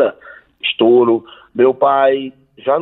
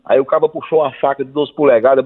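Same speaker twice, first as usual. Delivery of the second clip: ordinary talk, nothing marked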